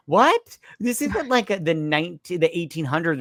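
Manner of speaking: high-pitched comic disbelief; incredulous. Acted. Host objecting to old-fashioned police tactics, incredulous. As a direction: burst out high on the first word, then protest. Host mocks the call for dogs.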